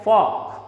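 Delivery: "fork" without an R sound; silent R. Shown as a demonstration. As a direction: say "fork" like "fawk". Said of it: "It is pronounced as". ("fork" में R silent)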